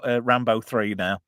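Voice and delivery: ordinary talk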